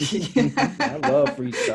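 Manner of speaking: slow, high voiceover voice, laughing. Pretend. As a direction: stretch it out like a playful radio promo. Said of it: "You've got a great voice over voice".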